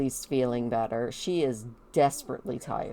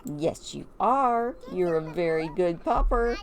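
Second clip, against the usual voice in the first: lightly acted kid doting on a dog; praising the dog; 6 semitones higher than usual. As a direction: gush a little over a good dog. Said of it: "She's like".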